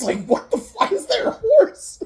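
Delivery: high, loud, laughing disbelief; incredulous squeal. Real, not acted. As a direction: squeal it as if you cannot believe your eyes. Disbelief at the horse gag.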